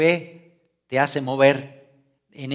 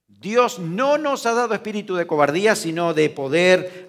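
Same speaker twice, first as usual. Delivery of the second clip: "no" raised and loud, "poder" slowed and louder; stress on no; 3 semitones higher, 4 dB louder than usual. Shown as a demonstration. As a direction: hit "no" hard, then lean on "poder". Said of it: "Vean ustedes". (énfasis en "no" y en "poder")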